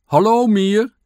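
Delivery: high, loud shouted greeting; calling out. A narrator's reading. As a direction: holler hello over a fence. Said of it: "riep de eekhoorn toen".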